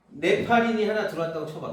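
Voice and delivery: usual speaking voice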